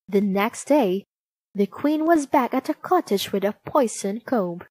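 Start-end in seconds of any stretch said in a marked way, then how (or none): none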